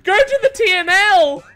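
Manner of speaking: high, loud urging; exasperated calling out. Real, not acted. Killer player urging survivor away from blocked pallet, exasperated. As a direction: yell instructions at a hopeless player.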